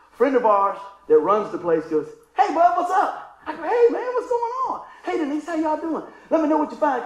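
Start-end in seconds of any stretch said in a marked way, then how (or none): 2.3-3.4 high, loud, drawn-out hail; retold hearty greeting. Friend calls out a greeting. 3.6-7.0 high-pitched acted hail between friends; retold hearty greeting. Acts out friends greeting each other.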